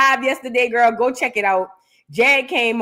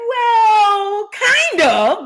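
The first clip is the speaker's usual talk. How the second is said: high, wailed, stretched "Well"; hammed-up reluctant concession; 8 semitones higher, 3 dB louder, much slower than usual. Host answering the singer's challenge, high mock wail. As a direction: whine it out as a mock admission. Acting out her reluctant reply.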